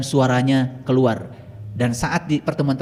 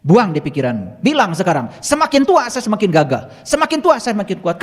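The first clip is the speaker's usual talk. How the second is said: raised, insistent coaching voice; forceful exhortation; 5 semitones higher, 4 dB louder than usual. Retold or imitated. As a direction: urge them on, louder and higher as it builds. Exhorts participant to declare strength. (seruan tegas penuh semangat)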